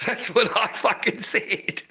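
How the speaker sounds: cracking up at your own story; helpless, breath-only swearing through laughter; laughing. Real, not acted. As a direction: laugh through the words until they dissolve. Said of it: "I fucking laughed my ass off afterwards".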